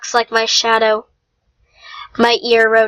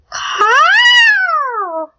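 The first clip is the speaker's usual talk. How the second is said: crow caw. Acted. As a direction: screech it out like a big glossy crow. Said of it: "cawed like a bird".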